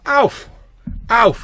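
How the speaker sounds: loud high shout across the street; calling out. Acted. Billy calling his mate Alf over, loud shout. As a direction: call your mate from far off.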